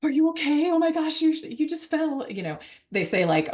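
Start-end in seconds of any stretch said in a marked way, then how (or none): 0.0-2.3 imitated high-pitched alarm; overreacting parent. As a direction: gasp and fuss over a fallen child, mock panic. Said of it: "to not react first".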